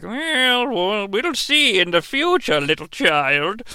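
a hint of a slow, soft old grandma; drawn-out, hushed granny warning; lightly voiced old grandma. Grandma warning her granddaughter, slow and soft.

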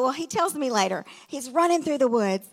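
usual speaking voice

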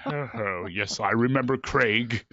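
slow, lightly imitated British thespian; Patrick Stewart. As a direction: recall him with drawn-out mock dignity. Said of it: "He goes".

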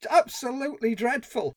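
high disbelieving no then dismay; appalled no. Real, not acted. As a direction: reject it in horror. Outraged at film line.

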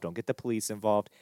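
ordinary talk

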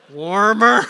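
egg-hunt hint. Acted. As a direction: call out encouragement to a searching child. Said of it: "what do you start to say".

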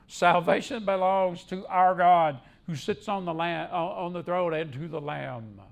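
loud proclamation of praise; strong, ringing pulpit voice; crying out loudly. Acted. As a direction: declaim it firmly as the crowd's cry. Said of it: "cried out in a loud voice".

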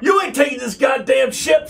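high, loud furious yell; angry shout. Acted. Sammy confronts the Salt Peterman, high and shouting. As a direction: shout him down in a rage. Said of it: "Sammy says".

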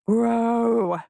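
drawn-out chanted spirit command; chorused command. A narrator's reading. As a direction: intone it as a triumphant chorus. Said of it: "they chorused".

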